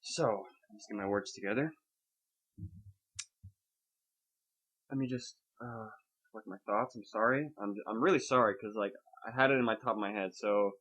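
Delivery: low, soft and faltering; subdued, apologetic. Real, not acted. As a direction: gather yourself quietly, speaking low. Apologizes while collecting their thoughts.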